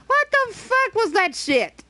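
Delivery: high, drawn-out disbelief; shocked outburst. Real, not acted. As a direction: react appalled to what just happened in the game. Startled outburst at the game.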